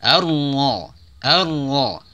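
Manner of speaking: Ar-Ra with a thick ra; heavy ra. Shown as a demonstration. Teacher shows the thick ra in Ar-Ra. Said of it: "Ra dibaca tebal".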